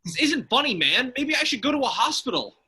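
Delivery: high, loud panicking plea; panicked whine. Acted. Man sprouting antlers pleads with friend, panicked. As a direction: freak out about the antlers.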